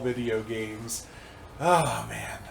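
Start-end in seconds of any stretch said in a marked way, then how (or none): none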